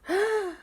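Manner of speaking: high startled intake of breath; sharp gasp. Acted. Jack spotting ghosts on his phone, gasping. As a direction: gasp in shock.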